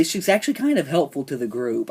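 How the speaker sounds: usual speaking voice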